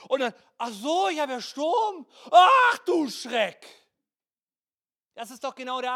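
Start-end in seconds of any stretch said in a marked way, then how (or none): 0.6-3.7 from a higher mock-casual "Ach so" to a loud, slow yelp; mock panic building. Preacher playing Peter noticing the storm, building to a hammed-up panicked yelp. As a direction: act Peter, cool then suddenly scared.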